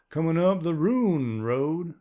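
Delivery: put-on a wary stranger's unplaceable accent; strange rural accent. Man voiced by narrator.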